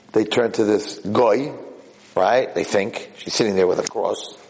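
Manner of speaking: usual speaking voice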